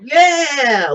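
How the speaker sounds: high mock crowd yeah; mock crowd cheer. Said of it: "the audience would go".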